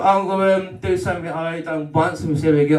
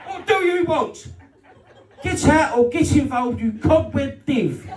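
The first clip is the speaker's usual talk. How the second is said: exasperated outburst; 12 semitones higher than usual. Retold or imitated. Comedian yelling at his fussy mum, high and exasperated. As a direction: shriek it at her in mock fury. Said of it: "I'm like".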